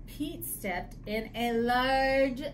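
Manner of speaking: high, slow, dramatic picture-book reading; story reading. Acted. Reader telling children about Pete's puddle, dramatic story reading. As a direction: draw out the disaster for young listeners.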